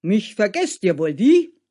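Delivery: offended woodcutter, leaping up loud at the end; indignant protest. Acted. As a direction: butt in, demanding notice. Woodcutter protests at being forgotten. (empörter Einwurf)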